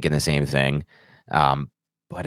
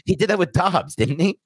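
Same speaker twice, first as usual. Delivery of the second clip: laughing; 12 semitones higher than usual. Real, not acted. Laughing at the Dobbs comparison.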